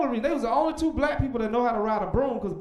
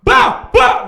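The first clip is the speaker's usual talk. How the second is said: high, loud mock babble; 12 semitones higher, 15 dB louder than usual. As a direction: ham up a loud blah blah.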